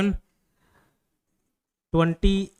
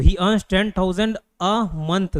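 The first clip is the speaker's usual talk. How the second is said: very slow, word by word; dictating slowly; much slower than usual. Shown as a demonstration. Teacher dictating the example to students, very slow. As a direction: stretch each word for note-taking.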